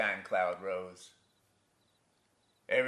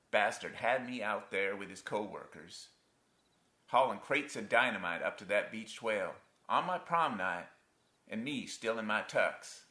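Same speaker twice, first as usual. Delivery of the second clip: usual speaking voice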